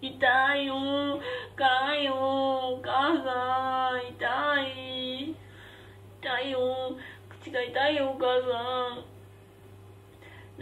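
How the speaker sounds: drawn-out childish wail, half-played; mock pained whining. Eater calling to mother over burning mouth, mock whining. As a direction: whine in mock agony, stretching the vowels.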